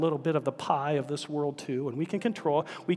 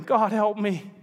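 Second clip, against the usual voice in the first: whimpering; 3 semitones higher than usual. Acted. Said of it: "ended whimpering".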